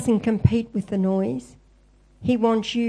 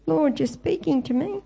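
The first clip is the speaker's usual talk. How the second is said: higher and gentle, a servant answering; humble listening prayer; 6 semitones higher than usual. Acted. Believer answering God's call, humble and higher. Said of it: "instead of saying".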